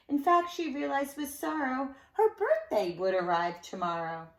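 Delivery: lilting rhymed read-aloud; sing-song storybook telling; story narration. A narrator's reading. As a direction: read it to children with bounce. Reads a rhyming picture book.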